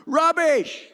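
a sharp, loud cry of "Rubbish!" at the preacher; heckler's shout. Retold or imitated. Heckler yelling at a teenage street preacher, loud retold shout. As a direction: bark it out like a heckler in the market. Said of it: "guy shouted out".

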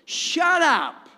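loud, high rebuke to the storm; commanding shout. Acted. Jesus rebuking the storm, shouting high and loud. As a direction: bark it at the devil. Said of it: "virtually, he says".

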